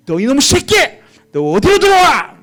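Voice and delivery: loud, high mock-furious mother; hammed-up parental rage; angry scolding. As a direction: yell at the returning son, drawing it out. Imagined mother angrily scolding son.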